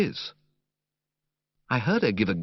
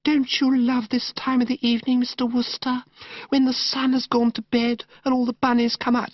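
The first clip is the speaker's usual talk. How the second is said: put-on gushing, dreamy young woman; 14 semitones higher than usual. Narrator voicing Madeline to Bertie in the park, high dreamy gush. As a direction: coo it like a sentimental girl.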